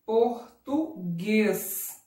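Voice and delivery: Português drawn out slowly, syllable by syllable; syllable-by-syllable reading. Shown as a demonstration. Teacher sounding out Português for pupils, slow syllables. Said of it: "Como que eu leio ela?".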